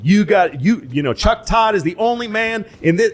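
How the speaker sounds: hammed-up campaign pitch; Clinton campaign voice.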